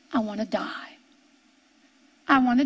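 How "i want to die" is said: low, soft and sinking; preacher sinks his voice quoting Jesus' despair; hushed. Acted. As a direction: let it fall almost to nothing. Quoting Jesus, repeated line.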